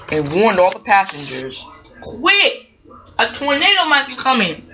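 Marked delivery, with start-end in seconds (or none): 2.0-4.7 urgent warning. Acted. James warns all the passengers.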